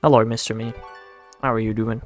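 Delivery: normal talking voice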